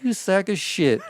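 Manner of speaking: high, slow disgusted insult; contemptuous. Retold or imitated. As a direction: insult the liar, dragging the words. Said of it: "I was like".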